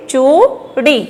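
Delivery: "चूड़ी" in two held syllables, "चू" rising; stressed long vowels. Shown as a demonstration. Teacher answering how to read it, stressed. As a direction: draw out "चूड़ी", leaning on "चू". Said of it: "तो कैसे पढ़ेंगे".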